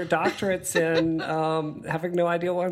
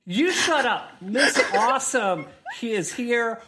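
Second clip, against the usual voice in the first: higher, laughing mock scolding; telling off inner voice; 6 semitones higher than usual. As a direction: shoo away a nagging voice. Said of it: "when that inner demon girl comes back".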